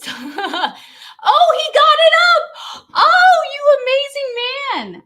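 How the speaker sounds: high, loud, slow gushing cry of delight; overjoyed squeal. Real, not acted. Thrilled to her husband for getting the giveaway ready, high and loud. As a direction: squeal it out in happy disbelief.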